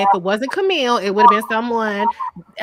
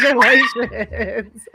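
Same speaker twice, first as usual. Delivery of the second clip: laughing congratulations. Retold or imitated. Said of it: "I'm like".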